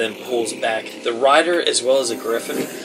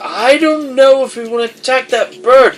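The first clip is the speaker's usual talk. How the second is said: high, loud panicky objection; alarmed protest; 10 dB louder than usual. Acted. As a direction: shout your doubts in a high voice. Down balks at attacking griffin.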